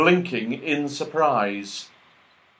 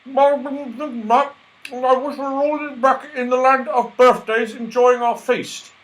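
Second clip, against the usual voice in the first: wishing voice; 13 semitones higher, 5 dB louder than usual. Acted. Franny makes the wish.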